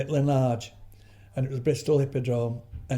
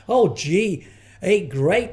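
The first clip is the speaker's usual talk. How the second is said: higher, louder, hammed-up American enthusiasm; mock American gushing; 5 semitones higher, 7 dB louder, much slower than usual. Mimicking the American actress, hammed-up American gush. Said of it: "American, but they haven't got a clue".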